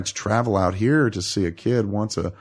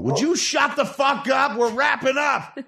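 angry shout. Acted. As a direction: yell them down, fake annoyed. Yelling at people in background.

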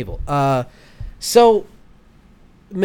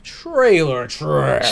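announcer voice; 3 semitones higher, 5 dB louder, much slower than usual. Pretend. Segment title jingle.

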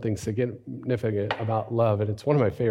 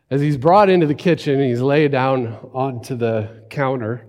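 ordinary talk, nothing marked

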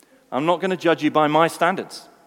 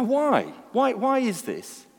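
ordinary delivery

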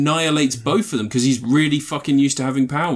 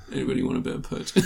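mumbled offer; 8 dB quieter, much faster than usual. Retold or imitated. Acting out an offer.

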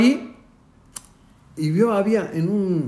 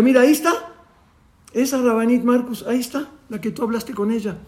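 higher, eager pointing out; excited pointing; 7 semitones higher, faster than usual. Retold or imitated. Batia pointing out the rabbi's wife to Nehama, high and excited. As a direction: show her friend who it is, excited.

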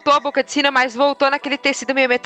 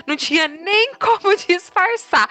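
laughing while talking; 7 semitones higher, slower than usual. Real, not acted. Laughing at her own embarrassing story.

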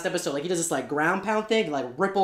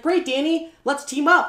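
mock-eager, higher and louder; eager businessman voice; 10 semitones higher, 5 dB louder than usual. Businessman greets Danny with eager, high mock cheer. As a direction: play the schemer greeting Danny with fake cheer.

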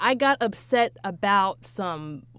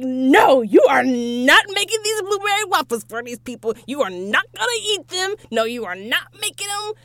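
loud, higher, reenacting a furious outburst; snapping, mock angry; 4 semitones higher, 5 dB louder than usual. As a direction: yell no at him like it is the end of the world. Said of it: "You snapped".

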